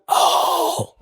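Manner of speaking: dawning realisation. Retold or imitated. Acts out his dawning realisation.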